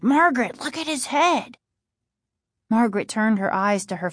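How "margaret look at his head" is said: high, urgent insistence; insistent. A narrator's reading. Casey warning his sister about their father, insistent. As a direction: press her to look, pitched up. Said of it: "Casey insisted".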